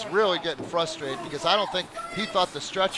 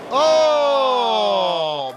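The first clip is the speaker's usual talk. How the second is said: loud startled "oh"; 3 semitones higher, 7 dB louder than usual. Real, not acted. Reaction to a superplex.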